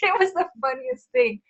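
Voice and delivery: laughing. Real, not acted. Laughing about the birth.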